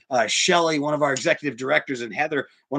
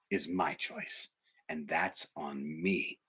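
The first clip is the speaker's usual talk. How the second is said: much softer, slow and lower; solemn hush; 7 semitones lower, 12 dB quieter, much slower than usual. Real, not acted. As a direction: own the responsibility gently. Solemn conclusion of lesson.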